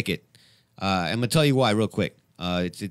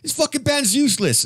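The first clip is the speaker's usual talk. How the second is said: much higher, loud mock British quote; imitating British bandmate's catchphrase; 5 dB louder than usual. Host quoting his British guest's favorite line, in mock British accent. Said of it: "one that you always say".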